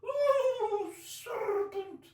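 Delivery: very high, loud, drawn-out bird shriek; shrieking pigeon. Acted. Pigeon shrieking at the serpent it fears. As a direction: rise to a shriek of outrage. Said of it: "raising its voice to a shriek".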